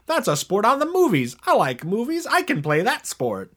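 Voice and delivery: high, loud cartoon character voice; squeaky toon bragging. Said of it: "the Toon Squad being like".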